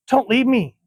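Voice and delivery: put-on high, pleading child's cry; a terrified girl begging not to be left; frightened plea.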